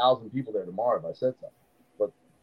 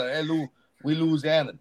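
put-on down south Louisiana accent; 3 semitones higher than usual. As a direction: slide into a south Louisiana accent, squeezing Louisiana to Luzana. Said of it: "so down south".

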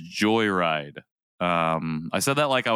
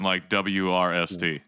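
ordinary talk

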